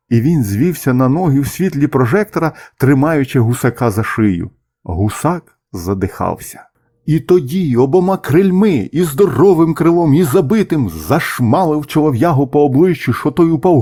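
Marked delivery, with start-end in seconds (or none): none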